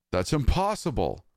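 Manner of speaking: narrator's shouted denial. A narrator's reading. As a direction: shout it in shock. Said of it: "I shouted".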